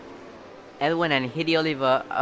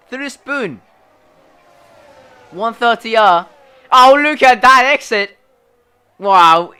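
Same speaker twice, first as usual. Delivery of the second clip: loud race commentator calling; excited commentary; 9 semitones higher, 12 dB louder than usual. Real, not acted. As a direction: hype up the corner exit.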